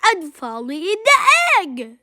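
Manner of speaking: plain reading voice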